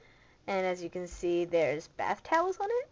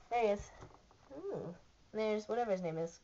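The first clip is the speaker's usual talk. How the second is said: ordinary delivery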